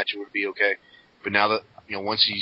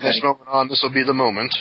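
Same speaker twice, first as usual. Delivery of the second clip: higher, louder mock declaration; mock promo; 5 semitones higher, 5 dB louder than usual. Parodying McGillicuddy's speech about his moment, mock proclamation. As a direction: proclaim it like a grand wrestling promo.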